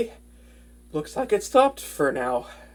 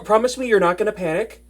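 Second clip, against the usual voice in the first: normal talking voice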